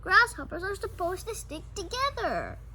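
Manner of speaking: high, loud calling after him. Acted. Nina calling after Sam, high and loud. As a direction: call down the hall after Sam. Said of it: "Nina called".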